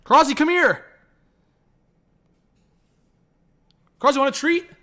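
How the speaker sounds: high-pitched call to a pet; calling the dog. Real, not acted. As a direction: coax a dog over in a high voice. Calling his dog over.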